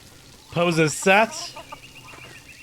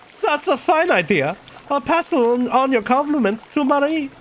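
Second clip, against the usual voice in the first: higher-pitched German character; German accent; 9 semitones higher than usual. Acted. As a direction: play Klaus in a raised German-accented voice. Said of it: "I was doing a fucking German voice for".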